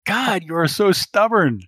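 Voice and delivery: high, loud exasperation; Kirsten. Acted. Kirsten chides stubborn Cecile, exasperated high voice. Acting Kirsten arguing on comm.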